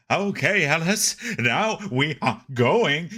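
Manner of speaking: mocking dub voice. Streamer mocking the film's dubbed father, stiff mock delivery. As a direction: parody the weird English dub. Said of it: "voice acting is so weird".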